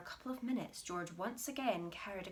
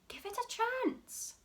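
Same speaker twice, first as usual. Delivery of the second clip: much higher, a bit louder; exasperated cry; 4 dB louder than usual. Acted. Mister Cranky telling his wife to wait. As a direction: plead impatiently. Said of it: "cried Mister Cranky".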